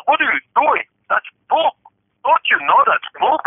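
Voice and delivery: high-pitched, put-on East Indian accent; indignant question in a mock East Indian accent; imitated East Indian accent. East Indian trucker questions man shaking blanket, high mock accent. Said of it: "the East Indian goes".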